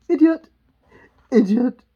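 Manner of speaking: loud, high burst of scorn; haughty outburst. Acted. Queen rehearsing her insult, loud and scornful.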